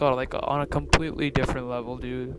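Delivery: ordinary talk, nothing marked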